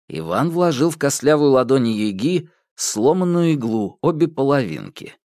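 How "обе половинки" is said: usual speaking voice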